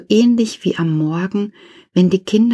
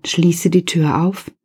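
plain reading voice

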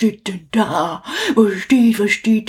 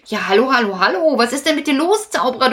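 ordinary talk

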